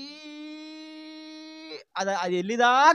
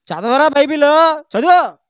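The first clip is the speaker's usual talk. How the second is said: loud scolding shout, higher; angry order; 7 semitones higher, 13 dB louder than usual. Retold or imitated. Mother scolding her silent son to read the Bible, angry shout.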